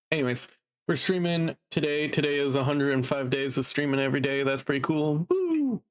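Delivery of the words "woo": sudden high cheer, short and bright; excited whoop. Real, not acted. Streamer celebrating a hundred and five stream days with an excited whoop. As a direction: whoop with joy at the milestone.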